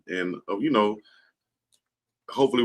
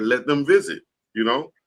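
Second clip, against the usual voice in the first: lightly voiced king daddy, pitched up; a hint of king daddy in a higher voice; 6 semitones higher, 3 dB louder than usual. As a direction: quote them like an easygoing king daddy. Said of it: "You sound like a king daddy".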